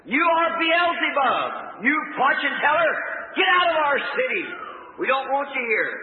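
high-pitched hostile crowd holler; hollered accusation. Retold or imitated. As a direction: cry out like an accusing mob. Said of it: "Others are hollering".

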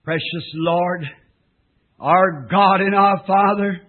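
slow, reverent praying voice; prayer. Acted. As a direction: pray it slowly and earnestly. Leads a closing prayer.

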